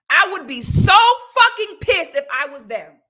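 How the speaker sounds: loud, furious outburst. Real, not acted. Vlogger raging at the movie's fans, loud and furious. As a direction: let the anger blow up on fucking. Angry rant at viewers.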